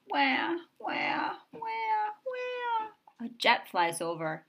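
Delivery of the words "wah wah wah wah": much higher wailing wah; mock baby cry. A narrator's reading. Imitating a crying baby for a child, high wail. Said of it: "A baby crying".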